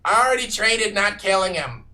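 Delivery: indignant little creature. Acted. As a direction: snap back in protest.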